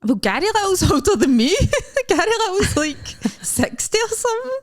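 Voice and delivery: high-pitched, laughing indignation; laughing protest. Real, not acted. Comic objecting to being called too old, laughing protest. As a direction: protest through real laughter, pitch shooting up.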